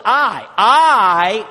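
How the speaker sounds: loud stressed I, I; emphatic I. Acted. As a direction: punch each I hard. Mocking Saul's I.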